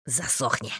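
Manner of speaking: low, soft brush-off; unconcerned, dropped voice; carefree. A narrator's reading. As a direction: dismiss it lazily, deep and quiet. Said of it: "беспечно отозвался тот".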